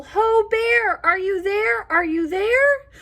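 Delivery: high, loud searching call. Acted. Friends calling for lost Bear in the storm, high loud call. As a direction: call into the dark woods for Bear. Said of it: "They call".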